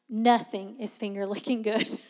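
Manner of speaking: words broken by laughter; laughing. Real, not acted. Retelling Miss June's etiquette lesson, laughing through it.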